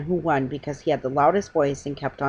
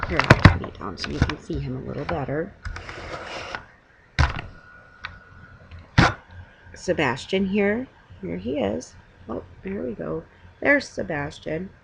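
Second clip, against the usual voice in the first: bedtime goodbye; 2 semitones higher than usual. Real, not acted.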